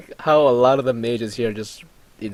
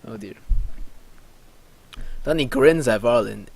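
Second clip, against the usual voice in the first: usual speaking voice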